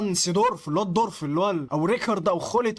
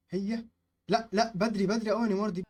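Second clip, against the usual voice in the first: ordinary talk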